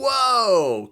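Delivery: long, high, loud mock whoa; astonished whoa. Hearing that patients are having panic attacks, loud drawn-out astonishment. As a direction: let it swell up in amazement. Retells own amazed reaction.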